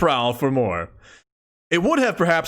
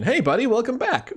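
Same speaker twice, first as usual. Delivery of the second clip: pet talk; 8 semitones higher than usual. Real, not acted. Greeting the cat on the desk.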